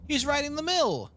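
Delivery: put-on high, excited little girl pointing; young girl's voice. Little girl shows her mother the cleric, high and excited. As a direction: squeal it like a thrilled child. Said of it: "stands a young girl".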